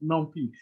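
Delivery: NumPy with the ending said "pee". Retold or imitated. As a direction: say NumPy to rhyme with "bumpy". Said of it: "people in France also calls it".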